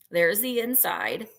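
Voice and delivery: ordinary talk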